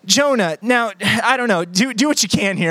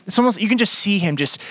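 ordinary delivery

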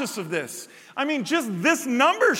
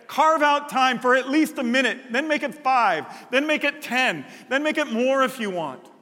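usual speaking voice

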